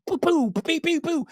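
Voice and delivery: high-pitched popping firework noises; playful mouth-made bursts; imitated fireworks. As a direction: pop off like little fireworks. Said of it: "fireworks and everything started going off".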